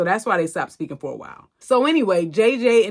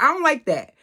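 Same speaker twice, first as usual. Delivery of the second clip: indignant rant; 8 semitones higher than usual. Real, not acted. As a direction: tell her off like she crossed a line. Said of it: "that girl just irritated my soul".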